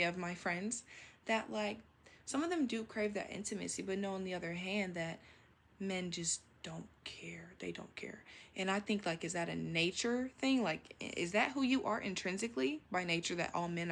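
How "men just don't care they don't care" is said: unvoiced whisper; hushed confiding gossip; whispered aside. Real, not acted. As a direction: lean in and whisper it. Confiding about men.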